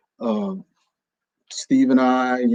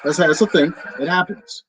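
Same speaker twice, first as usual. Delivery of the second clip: laughing; 5 semitones higher than usual. Real, not acted.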